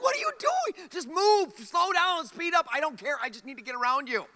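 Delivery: high-pitched retold road rage, shouted; frustrated yell. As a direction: yell at the driver, stuck behind him and late. Yelling at slow car ahead.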